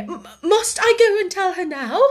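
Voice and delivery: high, stammering plea; timid, flustered question; panting dismay. A narrator's reading. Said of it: "panted Miss Amelia".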